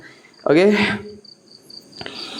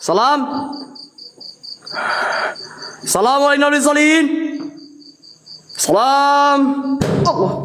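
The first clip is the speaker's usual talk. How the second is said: higher calling into the dark; 8 semitones higher than usual. Acted. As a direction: call out to an unseen presence. Calling out to the spirit.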